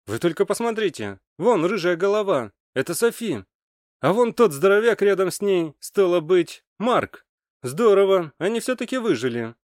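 high, excited and satisfied; delighted to spot survivors; pleased, excited. A narrator's reading. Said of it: "С удовлетворением в голосе". (радостно, с удовлетворением)

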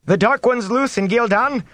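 high-pitched panicked villager; alarmed moan. A narrator's reading. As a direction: cry it out high, in dread. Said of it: "someone moaned".

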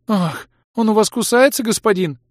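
high, plaintive coquettish whine; plaintive complaint. A narrator's reading. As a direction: whine pitifully to the master. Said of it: "жалобно пожаловалась шатенка".

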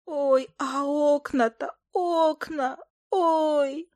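high, slow creature lament; groaning familiar. A narrator's reading. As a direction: wail over the damage in a put-on voice. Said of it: "стенула между тем Фая".

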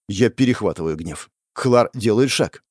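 usual speaking voice

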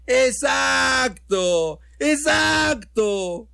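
high, long-drawn cries; drunken shrieked shout. Acted. Drunk interrupting the governor's speech, high drawn-out shrieks. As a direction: shriek it out, stretching the word right out. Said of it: "con unos chillidos que se oían hasta la calle".

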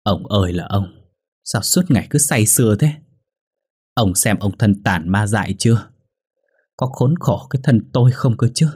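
resentful wife's lament, voice climbing; a touch of wounded reproach, rising toward the end. A narrator's reading. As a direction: pour out a wife's bitter grievance. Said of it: "giọng có chiều phẫn uất lắm".

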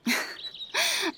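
one brief high laugh that falls away; short wistful laugh. Acted. Widow laughs briefly while remembering her brother. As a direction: let a quick laugh escape, then drop.

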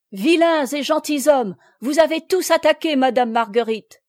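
loud, high, pressing proclamation; ringing denunciation. A narrator's reading. Noël denouncing all who attacked madame Marguerite, ringing denunciation. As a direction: accuse them all aloud.

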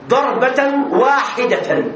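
slow ضربة واحدة, واحدة leaned on; stress on واحدة. Shown as a demonstration. Teacher stressing ضربة واحدة for students. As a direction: spell out ضربة واحدة beat by beat. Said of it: "التوكيد".

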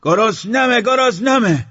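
very high, loud yell; ear-splitting hungry cry; piercing scream. Acted. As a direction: scream it at the top of your voice. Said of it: "بلندتر فریاد کشید".

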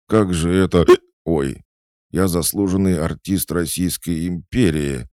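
high, loud, hiccuping protest; boastful drunkard, affronted. Acted. Drunk old performer boasting of his career, affronted. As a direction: puff yourself up through drunken hiccups.

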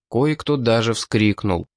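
plain reading voice

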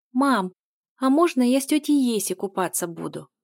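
put-on eager childlike request; pleading child. A narrator's reading. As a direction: ask mom eagerly for permission. Child asks mother permission.

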